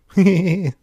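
loud, high gleeful laugh; impish crowing laugh. A narrator's reading. Fairy boasting to the children, impish crowing laugh. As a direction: crow with delight.

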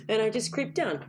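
usual speaking voice

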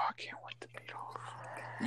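hushed whisper. Acted. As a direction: whisper it under your breath. Sudden whispered aside.

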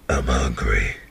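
very deep, sunken voice; stoned deadpan. Retold or imitated. Stoned buddy staring at his friends, deep low mumble. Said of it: "He looks at us and goes".

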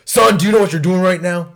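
loud, high, imitated police challenge; cop's shouted warning. Officer with hand on his gun warns kid, imitated shout. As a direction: shout it like an officer confronting someone too close.

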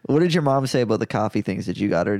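ordinary talk

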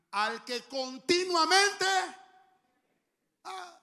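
very loud, slow preaching yell. Real, not acted. Pastor yelling his point at the congregation, very loud. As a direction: shake the room with it.

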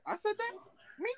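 high, soft mock-innocent question; lightly put-on "who, me?" voice; mock disbelief. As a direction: act out surprised innocence, high and quiet. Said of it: "yo, girls be like".

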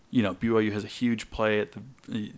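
usual speaking voice

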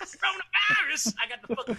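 hammed-up panic shriek; mock panicked shout. Mock panic at having caught the virus.

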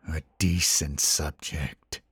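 low, slow, sinister man's voice; quiet malicious whisper. A narrator's reading. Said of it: "it whispered quietly".

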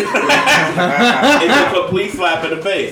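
slow, laughing emphasis. Real, not acted. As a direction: laugh through the words. Repeating point while laughing.

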